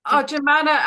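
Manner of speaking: normal talking voice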